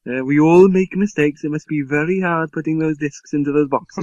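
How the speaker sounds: put-on patronizing Welsh accent. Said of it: "a Welsh accent".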